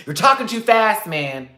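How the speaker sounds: high, loud retold complaint; mock heated complaint. Man complaining on the train he can't follow, heated mock yell. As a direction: throw it back at him hard. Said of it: "retold argument".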